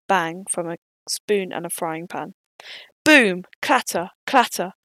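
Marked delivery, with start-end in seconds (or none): 3.0-3.4 loud boom. Acted. Loud bang wakes prisoners. 3.6-4.7 loud clanging clatter; clattering sound effect. Acted. Pans banging.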